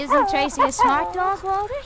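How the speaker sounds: high, eager voice you use to praise a dog; sing-song praise for the dog; pet talk. Acted. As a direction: show off the clever dog, bright and high. Showing off dog to husband.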